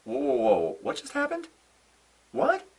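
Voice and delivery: high rising disbelief; imitating a stunned fan; mock shock. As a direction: act stunned, voice shooting up. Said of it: "It's like".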